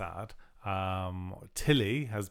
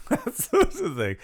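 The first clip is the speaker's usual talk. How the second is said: said through a high laugh; 13 semitones higher, 8 dB louder than usual. Real, not acted. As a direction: giggle the words out.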